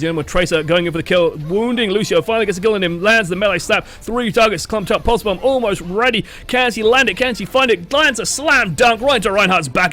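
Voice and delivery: excited play-by-play, high-pitched and building; sports commentator. Pretend. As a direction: call it like a live match climax. Mock play-by-play of a pulse bomb.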